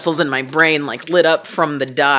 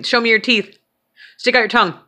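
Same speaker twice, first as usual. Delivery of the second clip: higher-pitched instructions to a patient; imitating a doctor's test prompts; prompting commands; 6 semitones higher than usual. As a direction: call out each simple instruction. Neurologist giving test commands.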